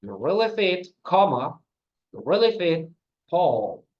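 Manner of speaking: emphatic, loud model with "Paul" dropped in pitch; falling intonation. Shown as a demonstration. Teacher showing where the comma is, falling on "Paul". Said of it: "So the pitch falls".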